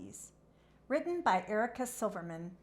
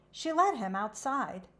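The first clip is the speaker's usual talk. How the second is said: ordinary delivery, nothing marked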